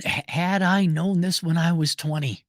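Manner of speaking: ordinary delivery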